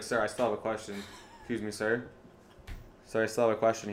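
polite plea. Retold or imitated. Student quietly pressing the professor for an answer, soft meek plea. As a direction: keep it small and polite. Pleading with professor during class.

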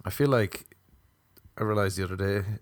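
ordinary delivery

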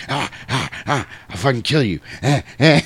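mock feral gasps and threats; snarling little vampire. Laddie behind Star threatens the brothers, mock snarling. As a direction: spit out broken little monster noises. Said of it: "he's behind her like".

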